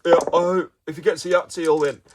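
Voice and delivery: put-on posh boy pundit. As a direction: answer as a silly posh boy commentator. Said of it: "That's my posh boy voice".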